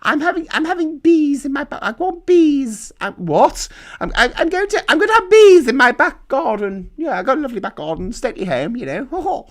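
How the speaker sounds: high, far-off plummy voice, slowing on I want bees; posh lady impression. Mocking the posh lady phoning the BBC, high plummy voice. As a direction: lay on the upper-class lady thick. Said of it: "this posh lady".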